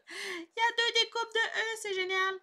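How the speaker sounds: high, loud excited squeal of words; gleeful high-pitched enthusiasm; delighted. Real, not acted. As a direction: burst out thrilled, pitched right up. Delighted at finding double letters.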